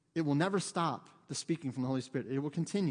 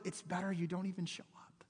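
hushed verdict; 8 dB quieter than usual. Retold or imitated. Preacher quoting Paul to the church, hushed. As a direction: drop to a near whisper. Softly delivering Paul's blunt verdict.

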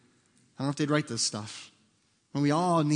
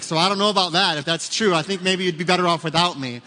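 louder, lightly voiced wife's retort; retelling wife's reply; 4 semitones higher, 7 dB louder than usual. Preacher retelling his wife's teasing text to the church, mock doubtful. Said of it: "She's like".

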